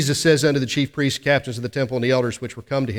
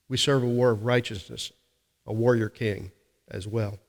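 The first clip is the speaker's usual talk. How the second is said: ordinary talk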